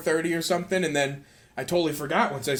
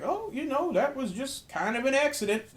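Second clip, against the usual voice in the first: put-on high, soft Southern accent; Vince Gilligan; 6 semitones higher, 5 dB quieter than usual. Answering a fan about that shot, weird Southern accent. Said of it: "weird Southern whatever accent".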